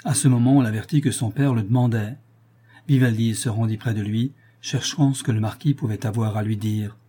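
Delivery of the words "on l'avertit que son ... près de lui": usual speaking voice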